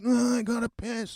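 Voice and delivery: imitating a sleepy woman's soft whine; mumbled, half-awake complaint; groggy whine. Retelling a woken guest needing the toilet, groggy whine. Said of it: "for fucking crying out".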